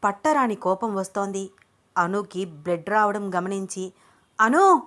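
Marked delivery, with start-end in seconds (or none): 4.4-4.8 high, loud cry of a name; urgent high shout; loud alarmed shout. A narrator's reading. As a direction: call out in alarm at full voice. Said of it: "అని గట్టిగా అరిచి".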